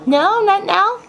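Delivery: ordinary delivery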